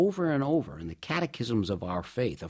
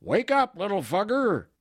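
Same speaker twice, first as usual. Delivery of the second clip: much higher, louder yell; retold yell; 10 semitones higher, 5 dB louder than usual. Father looming over his son's bed, yelling him awake. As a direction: bellow it at a sleepy kid. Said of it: "and he'd yell".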